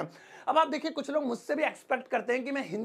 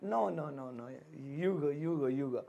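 timid refusal; 8 semitones lower, 8 dB quieter, much slower than usual. Shown as a demonstration. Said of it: "इस तरीके से".

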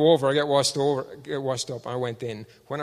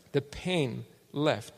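drawn-out, softer repeat; very slow emphasis; 5 semitones lower, 9 dB quieter, much slower than usual. Real, not acted. Preacher repeating the healing, very slow and soft. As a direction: stretch it out softly. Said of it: "And the pain left".

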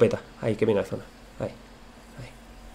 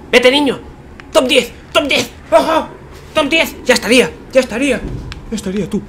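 excited shouting; 12 semitones higher than usual. Real, not acted. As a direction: shout it out in triumph. Celebrating placement win.

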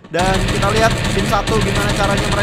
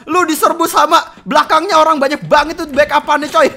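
excited shout; 7 semitones higher, 7 dB louder than usual. Real, not acted. Caster hypes a jump shot.

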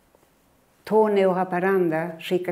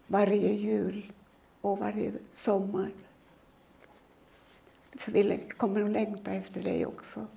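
soft, gentle comfort; calm the frightened child softly; soothing mother; 5 dB quieter than usual. Retold or imitated. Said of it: "jag blir lugnare när hon säger".

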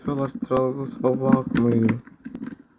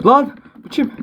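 much higher, startled yelp; startled cry; 12 semitones higher than usual. Real, not acted. Gamer startled by an unknown player. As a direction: jump at the sudden enemy. Sudden enemy appears.

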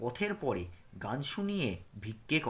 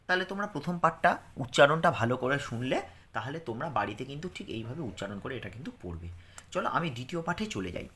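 ordinary talk

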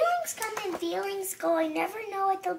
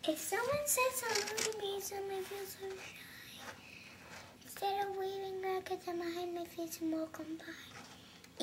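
much softer, timid near-whisper; shy whisper; 3 semitones higher, 15 dB quieter than usual. Acted. Child reading the shy page in a timid whisper. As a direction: hide the words, barely breathe them. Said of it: "a very funny voice for each tone".

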